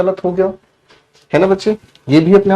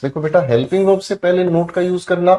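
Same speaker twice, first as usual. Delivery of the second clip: ordinary talk, nothing marked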